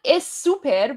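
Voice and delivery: "es super" with an added leading e; extra vowel before S. Shown as a demonstration. Streamer showing the mistaken accent, "es super" with an extra vowel. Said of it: "We don't say es super".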